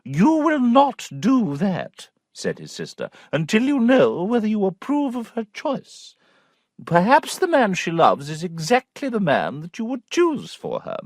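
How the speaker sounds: knowing aunt. A narrator's reading. Aunt voice, slow.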